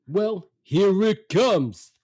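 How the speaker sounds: very slow, loud, high put-on dad voice; fed-up dad. Dad not even turning round to mother, fed up. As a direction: imitate a father announcing the inevitable. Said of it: "He just says".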